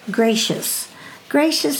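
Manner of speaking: ordinary talk, nothing marked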